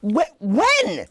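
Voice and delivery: high, loud stammered shock; hammed-up disbelief, blurted high; mock panic. Husband reeling from pregnancy news, mock panicked.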